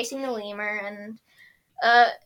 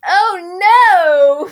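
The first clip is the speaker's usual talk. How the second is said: alarmed; 7 semitones higher, 13 dB louder than usual. Retold or imitated. Katara voiced alarm.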